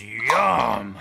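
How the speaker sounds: loud mock game-character "yum"; Snake. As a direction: belt it out as a joking impression. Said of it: "When Snake eats it, he goes".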